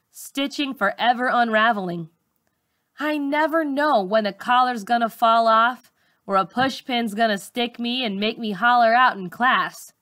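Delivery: ordinary delivery, nothing marked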